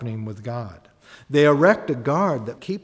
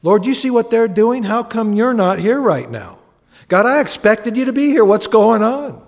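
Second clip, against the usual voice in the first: higher and louder, crying out to God; desperate plea; 7 semitones higher, 9 dB louder than usual. Retold or imitated. David crying out to an absent God, loud and pleading. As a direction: demand an answer from God. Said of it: "He wasn't afraid to say".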